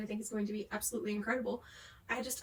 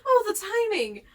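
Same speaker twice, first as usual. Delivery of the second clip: exasperated; 10 semitones higher, 10 dB louder than usual. Real, not acted. Cat interrupted filming.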